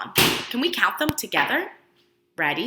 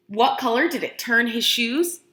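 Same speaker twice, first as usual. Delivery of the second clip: ordinary delivery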